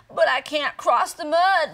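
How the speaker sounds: wailing frog. Acted. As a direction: wail it out in despair. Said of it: "little frog wailed".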